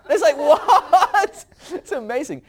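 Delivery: high, loud laughing what; cracking up at the story, loud and high; laughing disbelief. Real, not acted. Laughing disbelief at Sarah's denial.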